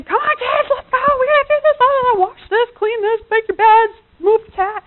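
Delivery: high, loud bossy mom orders; calling the kids. Retold or imitated. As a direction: hurry the kids along to clean.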